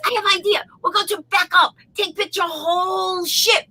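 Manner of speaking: high, eager mock Japanese accent. Japanese passenger pitching her idea to the pilot, high Japanese accent. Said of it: "And so she goes".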